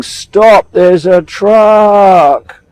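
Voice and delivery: loud, stretched-out warning yell; screaming warning. Retold or imitated. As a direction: shout to stop him stepping out. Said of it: "I'm screaming".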